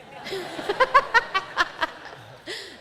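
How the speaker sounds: high, loud amused laugh. Real, not acted. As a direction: laugh at the answer. Laughing at student answer.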